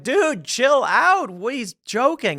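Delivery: high, loud, drawn-out imitation of pleading guys; mock exasperation. Party guys defending their joking friend, mock exasperated. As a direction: stretch it out, imitating buddies telling her to relax. Acts the guys calming her down.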